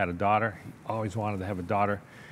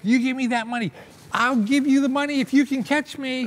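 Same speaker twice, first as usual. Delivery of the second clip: much higher and louder, furious back-and-forth; angry demand; 7 dB louder than usual. Retold or imitated. Uncle and boy shouting over the stolen money, heated yelling. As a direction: yell it like you've been robbed. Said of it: "Eva was pissed".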